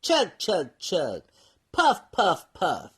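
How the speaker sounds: chanted chug chug and puff puff; playful train noises for kids; train sound effects. Acted. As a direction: sound out the little train, falling at the end. Sound effects opening the story.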